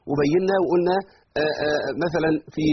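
ordinary delivery

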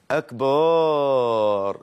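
أكبر stretched to a very long "akbaaar"; added alif. Shown as a demonstration. Teacher showing the mistake to students, wrongly lengthened. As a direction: hold a long aa after the ب in أكبر. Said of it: "عملت بعد الباء ألف".

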